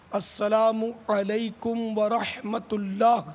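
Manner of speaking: very slow, each word spelled out, rising on اللہ; slow model greeting. Shown as a demonstration. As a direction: recite the longer greeting for learners to copy. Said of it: "السلام علیکم کہیں گے تو".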